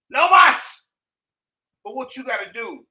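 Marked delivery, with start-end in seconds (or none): 0.1-0.8 higher and louder taunting shout; loud mocking call at Sonetta. Real, not acted. Taunting his opponent by name.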